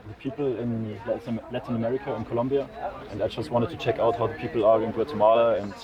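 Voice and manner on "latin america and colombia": usual speaking voice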